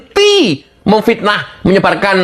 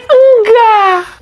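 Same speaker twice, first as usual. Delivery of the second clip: outraged no; 12 semitones higher than usual. Real, not acted. Host insisting attacks never stop, strained outcry. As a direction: cry out the denial.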